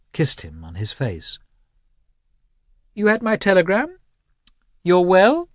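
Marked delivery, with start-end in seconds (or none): 3.0-5.5 lightly voiced old mother, pitched high; a glad old mother meeting her son; a hint of an elderly countess, high and bright; old countess greeting.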